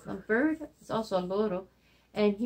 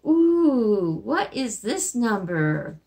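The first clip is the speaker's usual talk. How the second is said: ordinary delivery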